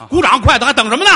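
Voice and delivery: calling out to the audience to clap; loud call to the crowd. Real, not acted. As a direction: whip up the applause. Urging audience to applaud.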